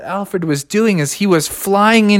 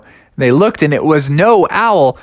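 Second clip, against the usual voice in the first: plain reading voice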